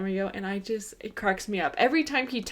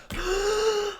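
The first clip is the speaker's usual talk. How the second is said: big high dramatic gasp; hammed-up horrified intake of breath; mock shocked gasp; 11 semitones higher than usual. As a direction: gasp like an appalled kid. Retold boy's shock at Santa.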